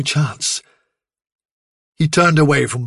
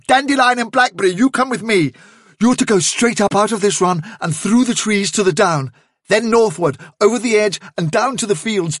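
higher-pitched, driving instructions, building in urgency; Hazel, urgently commanding; 7 semitones higher than usual. A narrator's reading. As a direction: take charge and hurry everyone along.

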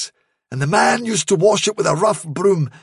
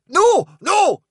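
high, pleading outburst; anxious cry; 11 semitones higher, 4 dB louder than usual. A narrator's reading. Guard dog begs fairy visitor to stay, crying out. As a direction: cry out to stop someone leaving. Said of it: "cried Roseby Woof".

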